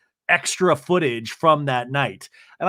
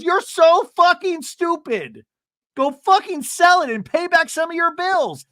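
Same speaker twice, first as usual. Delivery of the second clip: much higher, louder, stretched insult; hammed-up furious yell; mock screaming Emily; 13 semitones higher, 5 dB louder than usual. Podcaster imitates Emily yelling at Jen to sell the watch. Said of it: "Emily goes".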